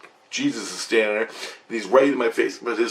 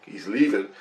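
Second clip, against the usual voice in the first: usual speaking voice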